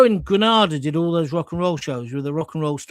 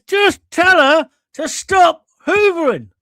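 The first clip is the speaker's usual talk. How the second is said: loud, slow, higher angry order; furious director; 11 semitones higher, 9 dB louder, slower than usual. Retold or imitated. Director losing patience over the hoovering, loud slow shout. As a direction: bark it down at the floor manager. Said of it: "So he says".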